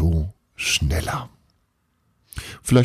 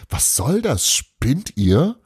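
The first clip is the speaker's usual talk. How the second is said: high, indignant questions; protesting; 7 semitones higher, much slower than usual. A narrator's reading. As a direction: protest with pitch jumping up. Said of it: "protestierte Bruno".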